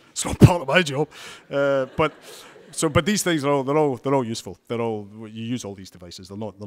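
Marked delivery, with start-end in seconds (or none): 0.1-3.6 loud, high-pitched mock protest; retold protest. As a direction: blurt it out as the startled newcomer, imitating his objection. Retold startled reply to boss.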